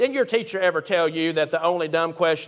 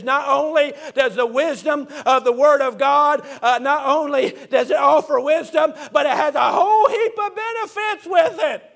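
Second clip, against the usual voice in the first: full-force preaching; 6 semitones higher than usual. Acted. Preacher exhorting his congregation, building to a shouted climax.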